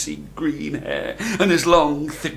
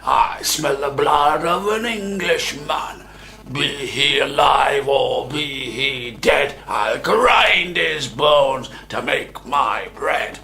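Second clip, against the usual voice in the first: booming giant; much slower than usual. A narrator's reading. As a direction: roar it out like a hungry giant. Giant's famous chant.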